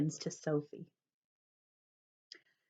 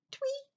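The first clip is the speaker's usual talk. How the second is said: bird tweet; 8 dB quieter than usual. Acted. Bird call imitated.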